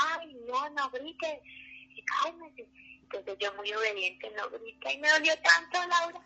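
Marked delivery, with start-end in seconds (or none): 0.1-2.7 higher, softer mock nurse scolding; imitating scolding nurses. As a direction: hush her like an annoyed nurse. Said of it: "se iban a decir". (enfermera regañando suave, más aguda)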